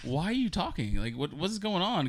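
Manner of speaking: high-pitched string of baffled questions; retold bewilderment. Woken in the dark by a girlfriend talking, retold bewilderment.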